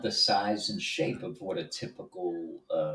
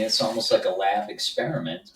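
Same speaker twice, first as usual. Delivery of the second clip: ordinary delivery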